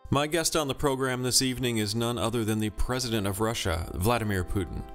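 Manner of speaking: ordinary talk, nothing marked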